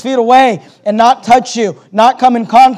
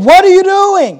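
high, louder mock-shocked exclamation; shocked onlookers; 9 semitones higher, 4 dB louder than usual. Preacher voices onlookers seeing a leper touched, shocked outcry. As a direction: imitate an appalled crowd crying out. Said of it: "they probably said".

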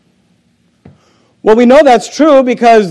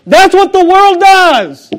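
higher, louder and slower; emphatic preaching; 10 semitones higher, 6 dB louder, slower than usual. Real, not acted. Preacher scolding the congregation, higher and louder. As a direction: hammer home the point.

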